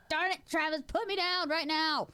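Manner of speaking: hammed-up whiny demand; mock protest. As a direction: insist while flailing. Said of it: "I'm like".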